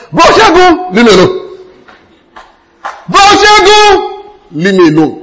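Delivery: high, loud, pleading call; a whiny high plea to be left alone; mock plea. Congregation members begging the preacher to leave them alone, high and loud. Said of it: "They say".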